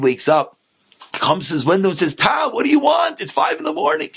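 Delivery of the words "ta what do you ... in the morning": higher-pitched acted grumble; mock-whiny son, pitched up; woken kid complaining. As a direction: protest at being woken so early.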